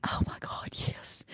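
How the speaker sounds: whispered, barely voiced glee; whispering delight at being asked to fire him; gleeful whisper. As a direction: hush it like a delicious secret.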